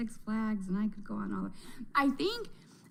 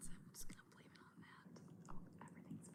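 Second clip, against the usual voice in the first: unvoiced conspiratorial whisper; 23 dB quieter, faster than usual. Real, not acted. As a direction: whisper the excuse like a secret. Joking aside.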